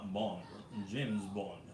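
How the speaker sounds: low, soft spy introduction; James Bond. Acted. Streamer showing chat the accent he means, as low James Bond. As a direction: drop it low and quiet, suave agent. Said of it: "like that James Bond".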